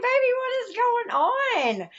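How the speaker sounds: pitched far up and slow, a bright delighted cry; delighted surprise. Retold or imitated. Mother greeting her glowing daughter at the visit, high delighted surprise. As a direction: sing it out high and slow in happy wonder. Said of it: "You know, I was like".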